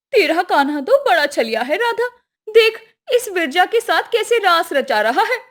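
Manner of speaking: high, loud, accusing companion; tattling girlfriend Lalita. Acted. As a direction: stir up your friend's jealousy.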